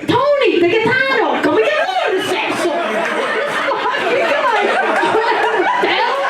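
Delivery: hammed-up outrage, rallying the whole place; mock mobster shout. Comic retelling calling the waiter over, mock shout while laughing.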